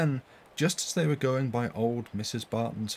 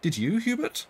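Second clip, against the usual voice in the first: much higher, pleading question; worried lady asks Hubert, higher and urgent; 12 semitones higher than usual. A narrator's reading. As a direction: press the boy for an answer, high and anxious. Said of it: "she added to me".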